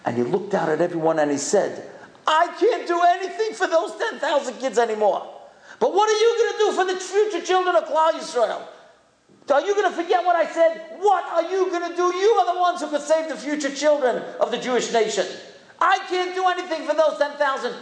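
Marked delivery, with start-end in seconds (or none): 2.2-8.6 high, raised, fervent cry; a rabbi after weeping, urging the boys in a high outcry; impassioned outcry. Retold or imitated. Weeping rabbi rises to address boys. 9.5-10.8 high, fast, shouted challenge; fervent shout. Retold or imitated. Rabbi challenges the boys. 11.0-17.7 high, raised urging; fervent outcry. Retold or imitated. Rabbi's fervent speech continues.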